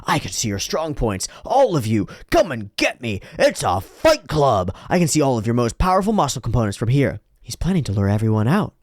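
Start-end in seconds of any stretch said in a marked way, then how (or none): none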